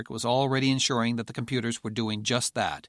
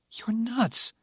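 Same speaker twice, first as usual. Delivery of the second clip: scornful outburst; 12 semitones higher than usual. Acted. Furness scolds Patrick.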